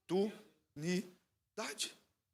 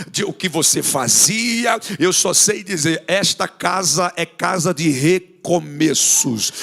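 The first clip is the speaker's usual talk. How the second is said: loud, rising preacher's cry over music; full-force altar-call preaching; 6 semitones higher, 15 dB louder than usual. Acted. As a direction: build it up to a shouted climax.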